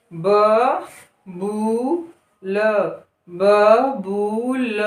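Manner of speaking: बबूल with बू held long; slow drawn word. Shown as a demonstration. Teacher reading बबूल slowly. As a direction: stretch बू in बबूल. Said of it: "समय लगेगा".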